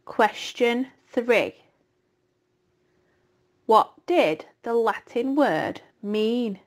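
slow, measured question reading; quiz reading. Acted. Teacher reads the slide questions to pupils, slowly. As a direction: read each question slowly for the class.

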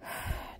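a soft weary sigh; bored sigh. A narrator's reading. Lonely Mary sighing at the gloomy room, soft sigh. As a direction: let out a long bored breath. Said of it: "she said with a sigh".

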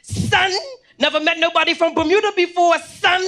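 hammed-up New York gangster, amazed; amazed gang guy voice. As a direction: sound blown away he met someone from Bermuda. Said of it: "He said".

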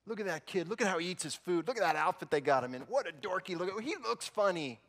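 mock the little kid's outfit; mocking older brother. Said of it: "He's competing with a brother".

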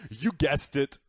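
ordinary delivery

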